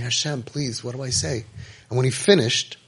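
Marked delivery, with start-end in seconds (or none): 0.0-1.5 soft, under-the-voice plea to God; hushed prayer. Retold or imitated. Listener privately asking God what to answer, hushed. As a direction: pray it quietly to yourself. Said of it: "I was saying".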